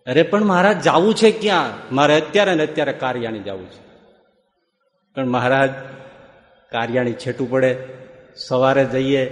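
ordinary talk, nothing marked

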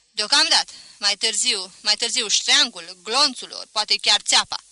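loud, emphatic threat; menacing threat. A narrator's reading. Student threatening the friend who would not kneel, menacing. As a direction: hit each punishment hard.

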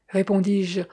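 usual speaking voice

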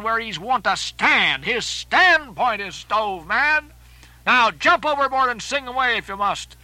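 high, forceful seaman's harangue; agitated Starbuck. Acted. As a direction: berate a shipmate, drawing out the key words. Voices Starbuck in the story.

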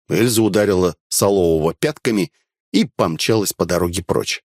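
usual speaking voice

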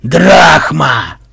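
loud, put-on offended growl from the smoke; offended monster voice. A narrator's reading. The boatman demanding payment from the smoke, loud and offended. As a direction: demand the coin, wounded and loud. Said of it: "донёсся обиженный голос".

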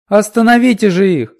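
high, loud pleading shout; child begging. A narrator's reading. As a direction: plead loudly with the elders. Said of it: "упрашивала она".

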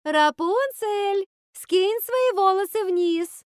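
very high, slow, loud drawn-out call; sing-song calling out. A narrator's reading. Witch calling to the tower window, drawn-out sing-song call. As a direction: call up to a high window, stretching each word. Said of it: "Готель взывала к окну башни".

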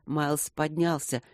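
ordinary delivery, nothing marked

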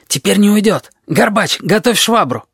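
high, eager hunter's command; excited urging. A narrator's reading. Lary orders Gorbach to arm himself, high excited urging.

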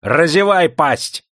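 high, loud, drawn-out bark; bellowed, slow order. A narrator's reading. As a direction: roar the command at her. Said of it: "рявкнул".

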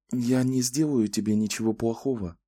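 calm her down kindly; pleasant soothing voice. A narrator's reading. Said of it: "Голос её был приятным". (мягкий, приятный голос)

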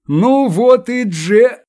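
high, slow, cut-off joyful call; bright greeting that breaks off; joyful shout. Acted. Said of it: "чей-то радостный голос".

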